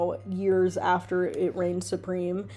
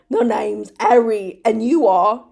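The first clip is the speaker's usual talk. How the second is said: put-on louder, slow hammy British accent; bad British accent; 2 semitones higher, 9 dB louder, slower than usual. Reader playing [name] introducing himself, hammy British. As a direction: draw out the introduction in mock British. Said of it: "my bad British accent".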